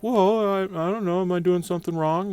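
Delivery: put-on bewildered young guy asking what he did; confused self. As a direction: play your own puzzled, innocent self. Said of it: "I'm like".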